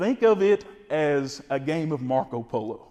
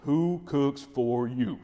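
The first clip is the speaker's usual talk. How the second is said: owl hoot; much slower than usual. Shown as a demonstration. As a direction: hoot it like a barred owl, drawn out. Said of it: "what you wanna do is basically say".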